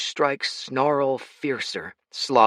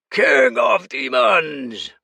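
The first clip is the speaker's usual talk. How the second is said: slow, much higher, loud roar; 12 semitones higher, 7 dB louder, much slower than usual. Acted. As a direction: bellow a challenge across the field. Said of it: "roared a gravelly voice".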